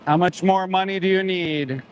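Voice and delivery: indignant yell; angry call-out. Real, not acted. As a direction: demand an answer across the lot. Protester yelling at slaughter trucks.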